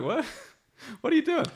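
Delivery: high, fast, laughing disbelief; mock shocked laugh; incredulous. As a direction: squeak it out like it's absurd. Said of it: "You'd just be like".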